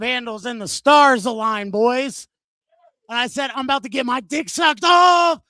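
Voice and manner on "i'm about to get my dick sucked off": loud, rising cry of triumph; exultant, building to a big finish; gleeful mock shout. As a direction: announce it like a hammed-up victory. Acted boast in retold story.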